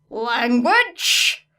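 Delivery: teacher scolding a student's swearing; called out. Acted. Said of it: "called Power Loader Motley".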